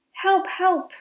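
high, loud cry for help; yelling for help. A narrator's reading. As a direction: shout up from the well. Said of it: "Rabbit yelled".

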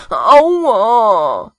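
loud, drawn-out "ow"; acted pained yell; cry of pain. A narrator's reading. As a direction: wail it out as if hurt.

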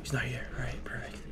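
soft relieved whisper. Real, not acted. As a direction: breathe it out, hiding. Hiding, whispering.

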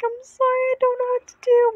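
flustered aunt holding the puke bucket, high mock wail; helpless panic. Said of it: "I'm like".

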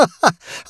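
high, hearty ringing laugh. Acted. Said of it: "He broke into a ringing laugh".

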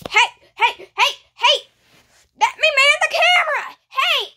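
high, urgent shouting; insistent high-pitched hollering; calling out. Acted. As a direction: yell to get their attention. Calling out to take over camera.

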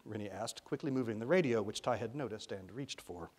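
plain reading voice

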